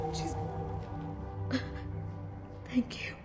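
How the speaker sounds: soft, choked thanks; tearful whisper. Acted. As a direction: barely get the words out. Grieving mother breaks off.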